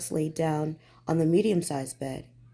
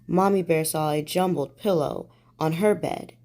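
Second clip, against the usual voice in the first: usual speaking voice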